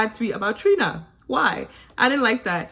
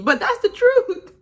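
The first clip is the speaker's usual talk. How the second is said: higher, louder and slow; slow, raised insistence; emphatic; 11 semitones higher, 4 dB louder, slower than usual. Real, not acted. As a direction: lay down the truth. Insisting after calling herself mean.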